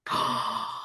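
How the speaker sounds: sharp unvoiced gasp; surprised intake of breath. Real, not acted. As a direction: gasp at a tricky question. Reacting to favourite song question.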